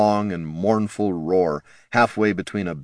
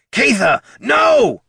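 much higher, loud desperate holler; 7 dB louder, much slower than usual. A narrator's reading. Bert yelling at Catha not to shoot the troll. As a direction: yell to stop her shot. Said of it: "Bert hollered".

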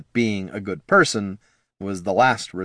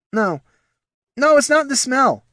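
gasped; 4 dB louder than usual. A narrator's reading. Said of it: "gasped Poldo".

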